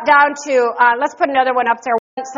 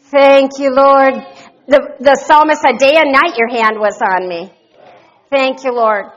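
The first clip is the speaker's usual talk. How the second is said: loud fervent prayer; 2 semitones lower, 6 dB louder than usual. Real, not acted. Pastor praying over the church, loud fervent prayer. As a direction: pour it out to God.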